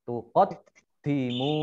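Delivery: "tuqaddimu" with double d and long "muu"; "tuqaddimu", final vowel stretched; doubled dal. Shown as a demonstration. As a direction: slowly recite "tuqaddimu", stopping on the dd. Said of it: "dalnya dibaca double".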